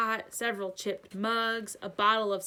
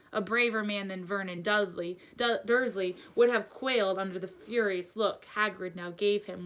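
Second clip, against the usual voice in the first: usual speaking voice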